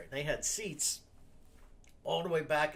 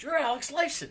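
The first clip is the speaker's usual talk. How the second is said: excited call-out; 13 semitones higher, 6 dB louder than usual. Retold or imitated. Fan calls out to celebrity.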